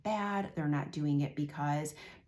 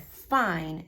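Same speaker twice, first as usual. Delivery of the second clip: curt, louder "Fine"; a grudging, annoyed give-in; mad parent; 7 dB louder than usual. Retold or imitated. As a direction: snap it out, fed up. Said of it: "and they were mad".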